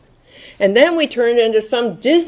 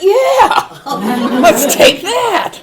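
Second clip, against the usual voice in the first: high, loud mocking laugh; sarcastic laughing agreement; laughing sarcastic yeah; 10 semitones higher, 7 dB louder, much slower than usual. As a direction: scoff at it with a big laugh.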